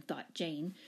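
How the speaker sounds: usual speaking voice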